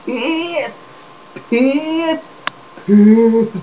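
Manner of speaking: imitating seething anger, drawn out; mock pissed-off emphasis on each word. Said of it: "That's how pissed he sound".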